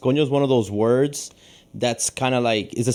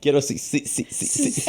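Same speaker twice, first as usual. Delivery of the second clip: laughing, stuck-syllable stutter; mock stuttering. Teasing friend about childhood stuttering, mock stutter. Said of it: "like, stuttering".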